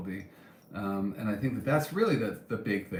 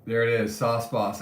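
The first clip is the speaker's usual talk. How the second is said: normal talking voice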